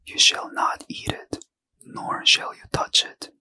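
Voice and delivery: ordinary delivery, nothing marked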